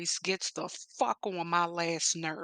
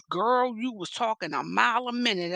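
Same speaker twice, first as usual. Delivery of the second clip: ordinary delivery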